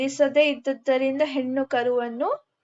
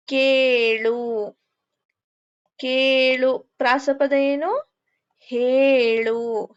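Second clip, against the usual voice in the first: slow, stretched rhyme words; teacher spelling out rhymes slowly; dictation; much slower than usual. Acted. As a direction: dictate each rhyming word slowly to pupils.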